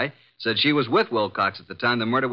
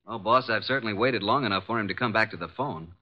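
ordinary talk, nothing marked

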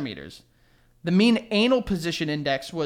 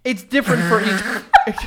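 laughing; 3 semitones higher, 7 dB louder, slower than usual. Real, not acted. Breaks up reading the answer.

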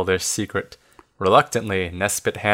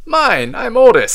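high, loud storybook scream; screamed claim; 12 semitones higher, 6 dB louder than usual. A narrator's reading. Rubus fighting for the key, screaming. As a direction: yell over the others, pitch way up. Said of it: "screams Rubus".

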